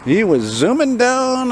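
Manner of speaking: slow, high swoop on "zooming down"; playful, high-pitched, elongated "zooming down"; drawn-out zooming. Pretend. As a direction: stretch "down" long and high. Repeated drawn out, imitating the zooming.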